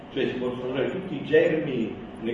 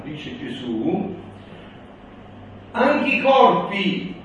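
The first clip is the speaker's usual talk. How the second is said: raised, louder solemn proclamation; preaching at full force; 3 semitones higher, 4 dB louder, slower than usual. Acted. Reads Jesus' words.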